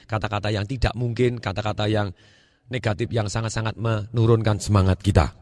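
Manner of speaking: usual speaking voice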